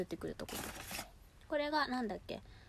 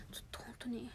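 excited hushed whisper; 10 dB quieter than usual. Real, not acted. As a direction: breathe it, thrilled. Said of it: "テンション上がってる今". (興奮気味のささやき)

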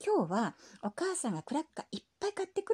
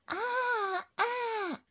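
put-on old man groan; 10 semitones higher, much slower than usual.

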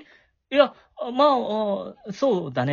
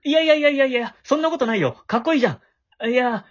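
higher and louder, tumbling out in a fluster; flustered denial; 4 semitones higher, 4 dB louder than usual. Acted. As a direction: protest in a panic, then trail into self-deprecation. Flustered boy denies, praises hero. (慌てて高く大きな声で否定する)

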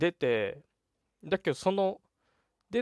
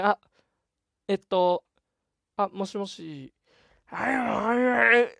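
raised, loud mock-raspy old man's voice with a laugh in it; imitating raspy old man; 7 semitones higher, 6 dB louder than usual. Man answering the pay phone, mock raspy old man voice. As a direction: play the phone-answering old man, hoarse and stuck on repeat. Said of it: "おじさんのなんかしゃがれた声で".

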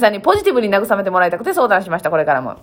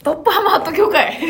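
トップハムハット教かい higher with a laugh; laughing retort; 9 semitones higher than usual. Real, not acted. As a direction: laugh through the word 教 in トップハムハット教かい.

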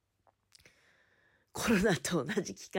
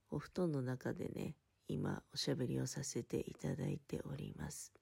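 pillow talk; 4 semitones lower, 6 dB quieter than usual. Real, not acted.

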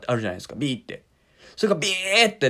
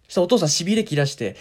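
ordinary talk

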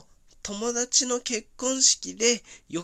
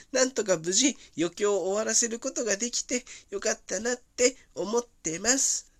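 ordinary talk, nothing marked

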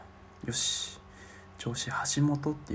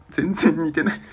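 laughing; 3 semitones higher, 11 dB louder than usual. Real, not acted.

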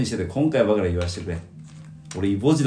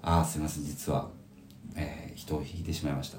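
quiet, softer, falling apology; grave confession; 6 semitones lower, 13 dB quieter than usual. Retold or imitated. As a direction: confess the accident to relatives. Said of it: "みたいなテンションで言ってた". (親戚に事故を打ち明けるように沈んだ声で)

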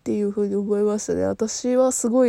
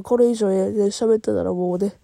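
usual speaking voice

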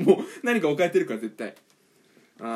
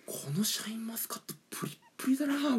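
食レポ; 6 semitones higher, 7 dB quieter than usual. Pretend. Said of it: "これ食レポの勉強にもなるからね".

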